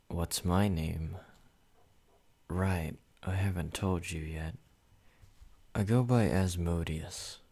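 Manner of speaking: usual speaking voice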